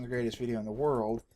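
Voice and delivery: ordinary talk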